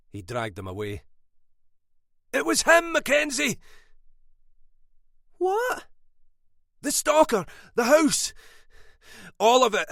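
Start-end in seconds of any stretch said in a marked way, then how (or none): 2.3-9.9 much higher, louder frantic cry; urgent yelling. A narrator's reading. Brother struggling free, shouting the truth to his sister. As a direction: blurt out the truth at full voice.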